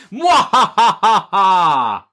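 slow, loud hammed-up villain laugh; evil cackle. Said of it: "Crazy Steve says".

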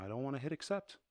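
ordinary talk, nothing marked

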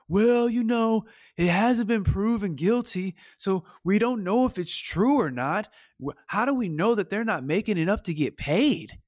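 high, put-on voice of a clueless fan; mocking idiot fan. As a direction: parody a dismissive fan excusing him. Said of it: "terrible people out there like".

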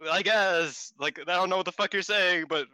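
lightly voiced baffled hosts; mock confused hosts. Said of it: "they were like".

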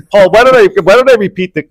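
high-pitched laughter through words; can't stop laughing while talking. Real, not acted. Host cracks up mid quiz.

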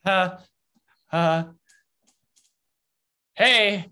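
high, nervous and placating. Acted. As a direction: squeak it out anxiously to calm them. Nervous guard calling to friends above.

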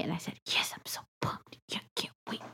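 hushed sarcastic whisper. Retold or imitated. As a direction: whisper it with mock glee. Mock excited retold reply.

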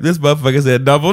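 high, loud, half-crying disbelief; laughing outrage. Real, not acted. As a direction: wail it in mock despair. Outraged at Dumbledore mistake.

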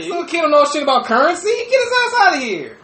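high-pitched, fast mock indignation; mock outrage. Griping about a kid talking currency, mock outraged. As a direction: voice leaps way up in comic outrage.

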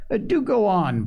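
lightly acted impatience; impatient. A narrator's reading. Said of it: "impatient at the delay".

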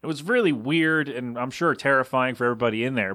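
ordinary talk, nothing marked

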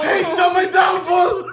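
mock despair shout. Mock despair after a wrong letter.

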